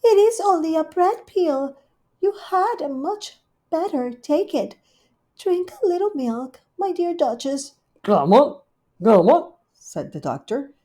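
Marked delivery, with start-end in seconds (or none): 0.0-7.7 high, fast, loud solicitous cat; Rivy the cat, high and bustling; fussing hostess cat. Acted. Rivy coaxes Duchess. 8.0-9.5 loud cawing magpie call with a laugh; loud bird voice cackling; magpie doctor. Acted.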